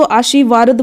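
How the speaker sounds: आशीर्वाद said aa-shi-vaard, र moved after वा; children's mispronunciation. Shown as a demonstration. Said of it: "कुछ बच्चे यहाँ पर बहुत कन्फ्यूज होते हैं". (आशीर्वाद को आशीवार्द बोलकर)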